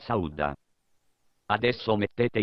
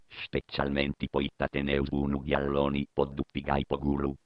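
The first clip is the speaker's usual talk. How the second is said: deep growl; 7 semitones lower than usual. Acted. Dropped into a growl.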